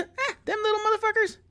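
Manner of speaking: high-pitched mock Texan; Texan local. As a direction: scoff like a caricature Texan. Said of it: "you ask anybody here in Texas".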